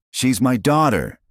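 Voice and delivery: put-on high, loud claim of his child; shouted. A narrator's reading. Minho shouts at Destiny about his daughter. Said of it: "he shouted".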